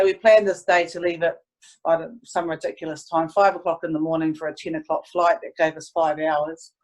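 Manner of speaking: usual speaking voice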